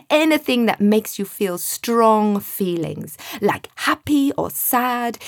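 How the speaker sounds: put-on a wise genie letting a boy in on a secret; knowing voice. A narrator's reading. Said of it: "said in a knowing voice".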